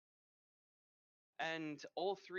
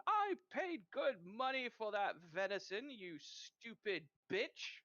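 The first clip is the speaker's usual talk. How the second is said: higher, louder angry yell, slowing on the insult; 7 semitones higher, 4 dB louder than usual. Acted. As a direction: spit the insult out slowly at his wife. Man berates his wife.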